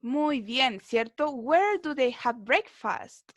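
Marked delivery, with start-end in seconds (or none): 1.3-3.3 slow, carefully spaced English question; slow model reading. Shown as a demonstration. Teacher repeating the student's question for the class, slow. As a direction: read it slowly so the class can follow. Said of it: "Dónde toman o comen su desayuno".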